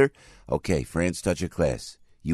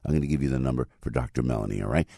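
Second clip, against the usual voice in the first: ordinary delivery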